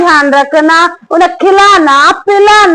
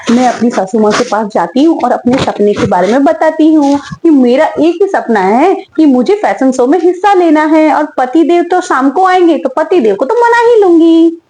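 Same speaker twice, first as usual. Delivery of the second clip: normal talking voice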